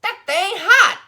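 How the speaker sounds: put-on high, loud bossy old barber barking orders; bossy old man. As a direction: boss the girl around like a cranky elder. Barber burns his mouth.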